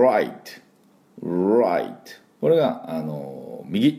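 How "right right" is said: right with a retroflex R from the throat; English pronunciation. Shown as a demonstration. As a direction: curl the R in right. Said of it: "Rの場合は".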